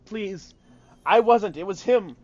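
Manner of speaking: high, loud, panicked begging; trembling plea. Acted. Dark elf on his knees begging the captain, panicked and high. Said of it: "he starts trembling, and he's like".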